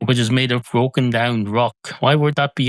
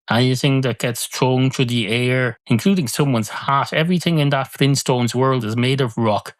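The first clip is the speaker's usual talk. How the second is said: ordinary talk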